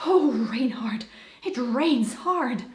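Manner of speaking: normal talking voice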